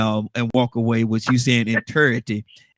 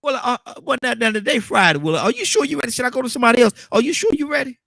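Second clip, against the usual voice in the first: teasing; 12 semitones higher than usual. Real, not acted. Teasing Willow before prayer.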